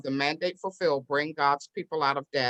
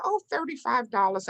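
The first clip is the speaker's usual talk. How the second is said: higher, offhand imitated voice; lightly voiced other person, pitched up; helpful official; 7 semitones higher than usual. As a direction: toss off the small fee in a high voice. Clerks quote the small fee.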